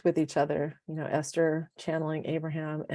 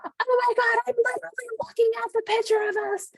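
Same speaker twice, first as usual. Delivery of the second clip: excited friend. Retold or imitated. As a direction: gush it like big news on the phone. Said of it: "And you're like".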